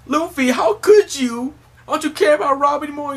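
very high, loud wounded little voice; Chopper pleading. Retold or imitated. Chopper scolds fake Luffy about Robin, very high and pleading. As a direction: squeak out the hurt accusation.

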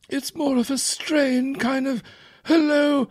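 high strained imitation of Thatcher; Margaret Thatcher impression. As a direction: squeeze the voice up like Thatcher. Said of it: "She kinda sounds like".

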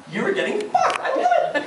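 high triumphant squeal; gleeful. Real, not acted. Comedian gloating at Apple users, high squeal. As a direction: gloat with glee.

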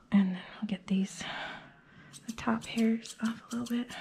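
breathless, half-whispered; out of breath from grooming. Real, not acted. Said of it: "Sorry, I'm out of breath".

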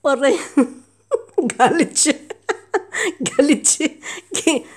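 loud laughing after a scratch; laughing yelp. Real, not acted. Laughing loudly after the cat's scratch.

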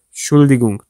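Entschuldigung cut down to 'Schuldigung'; shortened form. Shown as a demonstration. Teacher shows how natives say it, clipped-off first syllable in Entschuldigung. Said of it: "kısa bir şekilde söylerler".

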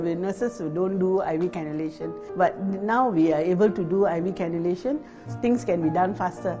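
ordinary delivery

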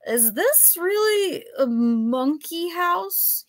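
put-on slow, high drunken wonder; drunk man. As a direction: let the words wander like a drunk. Drunk professor gawking.

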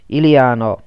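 loud call, falling at the end; calling again. A narrator's reading. Man still searching the dark, loud call.

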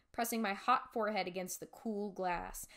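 ordinary delivery, nothing marked

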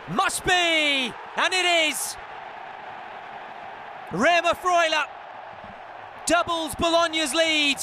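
building to a roar, then naming the scorer; goal call. Real, not acted. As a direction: erupt as the ball hits the net. Goal scored, commentator erupts.

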